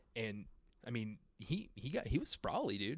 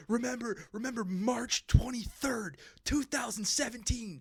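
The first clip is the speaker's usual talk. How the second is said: high, slow, strained dramatic warning; urgent mock message from the future; time traveler's warning; 9 semitones higher, slower than usual. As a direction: draw out a hammed-up prophecy. Acting cryptic time-traveler warning.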